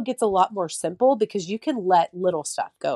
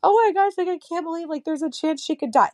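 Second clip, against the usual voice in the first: high, distraught quoting of her own panic; retold tearful panic; 9 semitones higher than usual. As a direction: recreate the terrified moment, pitched up, without real tears. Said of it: "I'm just sobbing".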